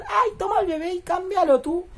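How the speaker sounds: mock careless parents. The young couple shoving the baby onto grandma, careless. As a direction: toss the baby off lightly. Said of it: "le decían a la madre del chabón".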